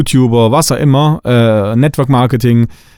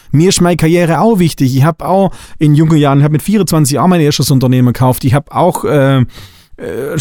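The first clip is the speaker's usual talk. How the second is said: normal talking voice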